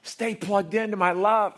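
high, loud, driving preacher; full-force preaching. Acted. Preacher to congregation, loud and high. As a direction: pound out God's love to the crowd.

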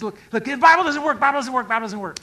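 loud, high, repetitive griping; hammed-up frustrated protest; mock complaint. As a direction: whine it insistently, imitating a doubter. Voices a complaining doubter.

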